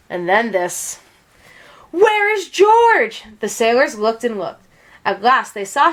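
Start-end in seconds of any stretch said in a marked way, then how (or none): 1.9-3.2 high, slow, loud call across the deck. A narrator's reading. Sailors calling for the missing monkey, a high slow shout. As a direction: search for him, calling his name.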